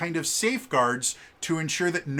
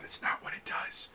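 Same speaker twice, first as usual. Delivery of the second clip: whispered aside; 11 dB quieter than usual. Real, not acted. Aside correcting own wording.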